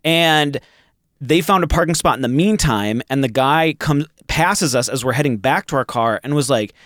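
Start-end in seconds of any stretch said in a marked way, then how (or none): none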